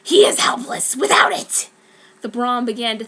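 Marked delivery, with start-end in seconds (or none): 0.0-1.7 high, loud hissed gloating; gloating whisper. Acted. The broom gloating over Harry's wand. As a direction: whisper it with glee.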